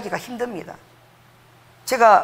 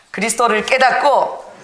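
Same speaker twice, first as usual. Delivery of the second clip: slow, a little louder demo greeting; model greeting; 3 semitones higher, 4 dB louder, slower than usual. Shown as a demonstration. Preacher showing the church how to greet neighbours, slowly. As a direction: say it slowly so they can copy. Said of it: "이렇게 인사하세요".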